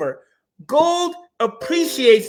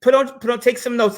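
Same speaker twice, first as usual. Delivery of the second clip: ordinary talk, nothing marked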